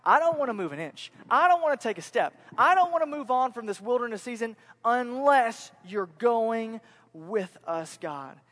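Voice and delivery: insistent plea, loud then slowing right down; emphatic appeal, fading on the final word; Moses. Retold or imitated. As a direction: refuse to budge, then draw out the condition. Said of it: "You notice what Moses is saying".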